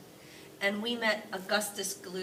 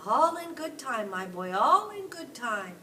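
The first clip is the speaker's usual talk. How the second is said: louder, slow, drawn-out tease; teasing grandpa; 4 semitones higher, 8 dB louder than usual. Acted. Grandpa Joe teasingly delaying opening the candy. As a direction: make the boy wait.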